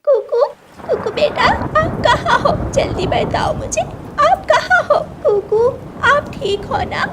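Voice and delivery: put-on high, loud, panicked calls for her child; frantic calling. Mother bird frantically calling her lost chick, panicked cries. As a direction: call out desperately for the lost baby.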